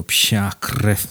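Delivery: plain reading voice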